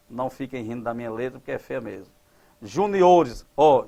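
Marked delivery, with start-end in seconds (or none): 2.6-3.5 "Juniores" stressed on the O. Shown as a demonstration. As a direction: lean on the O in "Juniores". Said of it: "juniores passa a o". ("Juniores" com a tônica no O)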